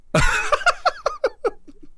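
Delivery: high, loud laughing fit; burst of laughter. Real, not acted. Co-host joking that religion encourages guns, high loud laughter. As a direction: crack up at your own quip.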